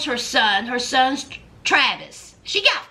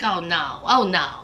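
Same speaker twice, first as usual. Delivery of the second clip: low mock refusal; 10 semitones lower than usual. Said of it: "He was like".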